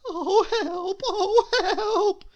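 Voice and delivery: very high, loud frantic shriek; panicked shriek. A narrator's reading. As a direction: scream for help in total panic. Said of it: "he shrieked".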